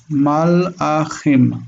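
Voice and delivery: Malachim very slowly as mal, a, chim, broken after the l; syllable by syllable. Shown as a demonstration. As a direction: sound out Malachim in slow separate pieces with throaty ch. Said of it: "The Aleph is a break".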